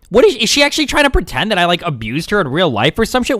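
incredulous, worked-up rant with big pitch jumps; exasperated outburst. Real, not acted. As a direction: demand answers, voice climbing in real exasperation. Upset at being accused.

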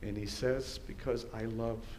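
soft, low and unhurried; hushed, tender. Acted. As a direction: ask and answer gently, well below preaching voice. Hushed question and tender answer.

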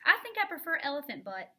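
loud, high mocking jab; taunting the rival with a loud, high jab. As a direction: sneer it out to get under her skin.

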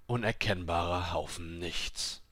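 ordinary delivery

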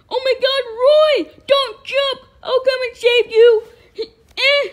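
very high, loud, crying panic; panicked cry. Acted. Mother panicking at Roy about to jump, crying shout. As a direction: scream at him not to jump.

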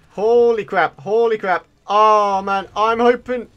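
ordinary talk, nothing marked

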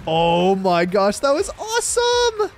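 slow, drawn-out cheer, loud and soaring high; thrilled shout. Real, not acted. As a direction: whoop after a huge explosion. Watches the dynamite explode.

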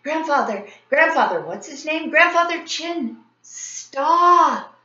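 high, loud group shout. A narrator's reading. Kids shouting up to grandfather to stop the kite, loud. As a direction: call out together, urgent. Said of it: "started shouting".

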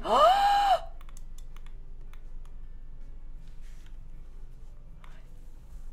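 sharp, high intake of breath; big high-pitched gasp of surprise; shocked gasp. Real, not acted. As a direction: gasp in real astonishment. Shocked at the next build revealed.

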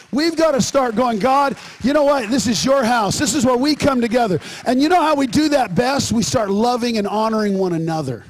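loud, urgent sermon exhortation; forceful preaching. Real, not acted. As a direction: preach it out to the whole congregation.

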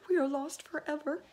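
high, loud despair; despairing shout. A narrator's reading. Ladybug shouting in despair. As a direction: cry out hopelessly. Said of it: "shouted the ladybug".

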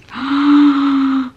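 gasp. Real, not acted. Sees cute stickers.

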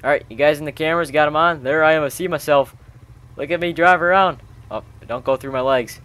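ordinary delivery

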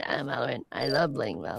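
low robotic speech synthesizer; Stephen Hawking voice. Pretend. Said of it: "Got a little Stephen Hawking voice going on there".

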